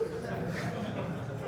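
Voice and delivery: silent wheezing laugh; breathy laugh. Real, not acted. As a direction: crack up at own story. Laughs after yelling bit.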